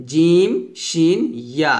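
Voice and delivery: slow, drawn-out জিম, শিন, ইয়া; recited letter names. Shown as a demonstration. Teacher has students read along, slowly drawn জিম, শিন, ইয়া. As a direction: stretch each of জিম, শিন, ইয়া separately. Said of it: "আমার সাথে পড়েন".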